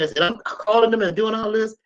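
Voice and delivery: ordinary delivery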